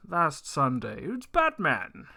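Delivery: ordinary talk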